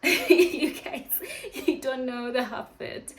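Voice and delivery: laughs through the words, pitch up; giggling as she teases the viewers; laughing. Real, not acted. Telling viewers about trying, laughing loudly.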